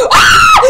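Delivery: high loud scream; high frightened scream; fright scream. Real, not acted. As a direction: scream as the monster comes. Scream when chased.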